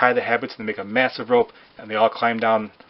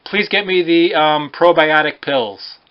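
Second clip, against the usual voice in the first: higher, louder and slow, drawn out; loud high call; 7 semitones higher, 7 dB louder, slower than usual. Acted. Blurting a loud request between jokes, high and slow. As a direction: call it out loudly, stretching the words.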